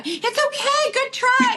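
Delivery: very high, sing-song comfort; mock consoling mom. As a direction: coo it way up like a cheery team mom. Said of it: "I'm the mu".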